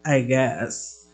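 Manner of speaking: low, soft grudging mumble; reluctant I guess. Retold or imitated. Brother Ivan answering a request, grudging and soft. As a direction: shrug it off grudgingly. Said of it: "And you're like".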